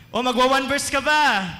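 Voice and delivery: high, loud put-on questioning voice; mock quizzing call. Preacher teasing a churchgoer about reading verses, loud put-on voice. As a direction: fire the question like a teasing leader.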